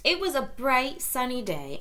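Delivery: usual speaking voice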